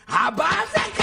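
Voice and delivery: indignant shout. Acted. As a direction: shout it out as an outburst. Same words shouted back. (צעקה נזעמת)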